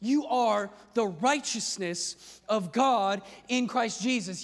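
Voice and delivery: ordinary talk, nothing marked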